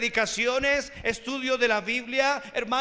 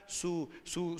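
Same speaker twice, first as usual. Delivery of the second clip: ordinary delivery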